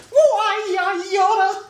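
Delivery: very high, loud mocking squeak; mock the odd voice he used; mimicking the voice. Friend mocking the Minotaur's voice, very high and loud. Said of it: "he's just".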